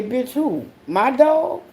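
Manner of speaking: high, incredulous who; indignant disbelief. Retold or imitated. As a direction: pitch climbing high in outrage. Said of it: "and I say".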